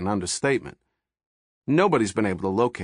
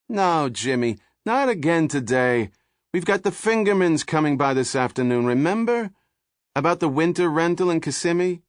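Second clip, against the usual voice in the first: nagging wife; 9 semitones higher, 4 dB louder than usual. A narrator's reading.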